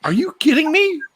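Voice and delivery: high-pitched shocked disbelief; incredulous. Real, not acted. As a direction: react in high, appalled amazement. Shocked at peroxide toothbrushing story.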